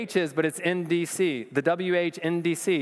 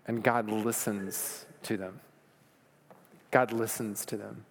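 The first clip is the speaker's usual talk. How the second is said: hushed awe; 6 semitones lower, 5 dB quieter than usual. Real, not acted. Preacher tells church God listens, hushed. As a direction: let it land in a reverent hush.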